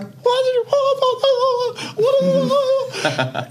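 high sung wail. Retold or imitated. Voiceless shock at the threat.

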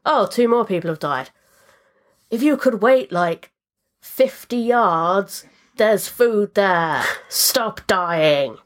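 mock exasperation at the dying crew; exasperated game mockery. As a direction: spell it out slowly in disbelief. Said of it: "It's just like".

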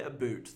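"boot" said for about, with an oo; Canadian "boot" vowel. Shown as a demonstration. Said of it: "do you think Canadians say".

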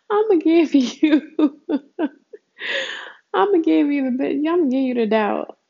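high, loud, laughing through words; laughing while talking. Real, not acted. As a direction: giggle out the punchline.

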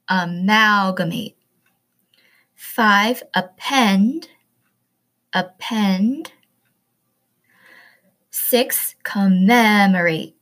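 each word said twice, clear and loud, teacher voice; pronunciation drill. Shown as a demonstration. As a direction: read the word list slowly and carefully for pupils to repeat. Reads the vocabulary list aloud.

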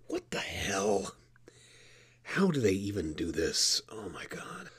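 exasperated low whisper. Acted. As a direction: whisper it in disbelief. Frustrated with unlabeled buttons.

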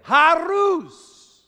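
high, loud command; preacher thundering you must forgive; commanding shout. Acted. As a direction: bark it as an order. Preacher voicing Jesus's command.

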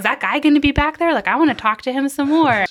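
high, loud, eager pleading; retold eagerness, pushed up and loud; excited child. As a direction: imitate a kid bursting with excitement. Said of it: "excited to be like".

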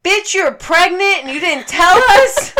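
high, loud reenacted tirade; outraged yelling. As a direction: scold the cat at full volume. Acted scolding of the cat in labor.

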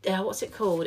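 ordinary delivery

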